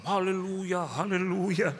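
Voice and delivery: hammed-up joyful hallelujah; gleeful hallelujah. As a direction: imitate a greedy brother rejoicing. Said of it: "Adik saya nih di belakang".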